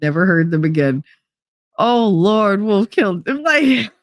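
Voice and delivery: ordinary talk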